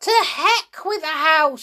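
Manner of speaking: high, loud, dismissive cry; defiant shout. A narrator's reading. Grandma dismisses George's protest, defiant shout. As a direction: brush him off with a shout. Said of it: "she shouted".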